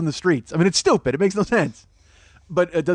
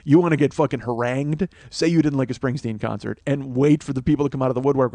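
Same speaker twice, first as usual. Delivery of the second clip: usual speaking voice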